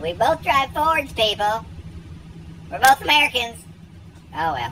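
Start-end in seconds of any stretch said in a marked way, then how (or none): 0.0-3.6 louder, higher exasperated plea; retold plea. Vlogger retells pleading with haters, raised and higher. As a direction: appeal to the crowd. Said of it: "I'm like".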